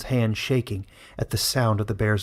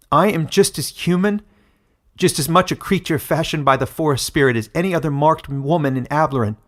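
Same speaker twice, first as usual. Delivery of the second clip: defiant; 4 semitones higher, 6 dB louder than usual. Acted. Daughter defies her mother.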